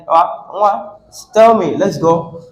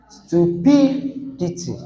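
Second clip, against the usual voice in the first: stu-PI-dity, PI drawn out and raised; stress on second syllable; 5 dB quieter, slower than usual. Shown as a demonstration. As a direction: slowly lean on PI in "stupidity". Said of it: "stress every single syllable here".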